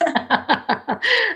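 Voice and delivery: high short laugh; soft laugh. Real, not acted. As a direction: laugh lightly. Laughs at own joke.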